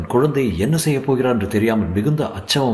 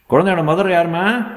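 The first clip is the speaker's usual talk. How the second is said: high, brisk doctor's question; doctor's demand; 9 semitones higher, 4 dB louder than usual. A narrator's reading. Doctor asking for the baby's mother, high peremptory call.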